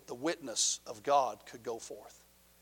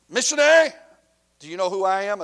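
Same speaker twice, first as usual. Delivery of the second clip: high, loud challenge from the gang leader; menacing gang boss; 5 semitones higher, 10 dB louder than usual. Retold or imitated. Gang leader confronts the missionary, loud menacing challenge. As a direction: call him out like a street boss.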